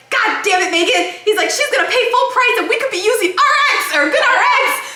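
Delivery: worked-up retelling of his panic; panicked husband. Retold or imitated. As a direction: imitate a husband fretting about money. Husband panicking over full price.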